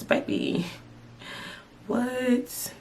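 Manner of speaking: dropped to a hushed, sassy aside. Real, not acted. Telling viewers about Amazon, a hushed sassy aside. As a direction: lean in and hush it, full of sass.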